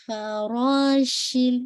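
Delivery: فراشل with spreading air on ش; slow recitation demo; spread sheen. Shown as a demonstration. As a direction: let ش in فراشل hiss out. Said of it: "شین کی آپ کی جو تفشی ہے".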